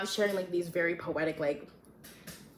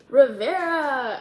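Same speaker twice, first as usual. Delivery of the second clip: calling out; 6 semitones higher, 9 dB louder, much slower than usual. Acted. A voice calls from behind.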